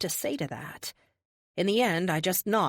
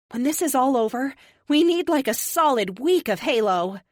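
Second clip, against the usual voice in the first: bright, eager and louder; excited; 7 semitones higher, 6 dB louder than usual. Acted. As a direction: gush about the plan. Eager plan with best friend.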